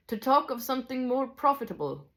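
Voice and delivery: high Scottish character voice; Scottish teacher, high and lilting; attempted Scottish accent. A narrator's reading. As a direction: prompt them in amateur Scots, pitched high. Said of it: "I'm not good at a Scottish accent".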